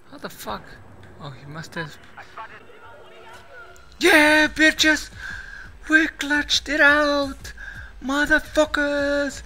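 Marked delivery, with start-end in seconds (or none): 4.0-9.4 high, loud triumphant yells; victory yelling. Real, not acted. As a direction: roar out the win. Victory celebration after clutch win.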